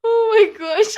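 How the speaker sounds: high, loud laughing gasp; loud amused oh my gosh. Real, not acted. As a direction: burst out laughing. Reacts to tea joke.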